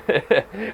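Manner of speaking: short high-pitched laugh; giggle, caught out; sheepish laugh. Real, not acted. Laughs at failed Arnold accent.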